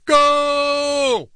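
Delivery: high stretched mock goal call; hammed-up announcer goal yell; bad imitation of goal call. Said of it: "my very bad imitation".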